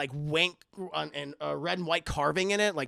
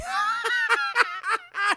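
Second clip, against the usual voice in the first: high, loud doll cackle; mock villain cackle; 3 dB louder than usual. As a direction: cackle in wicked delight as the doll. Chucky reacts to the news.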